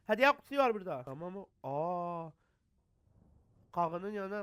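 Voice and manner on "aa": usual speaking voice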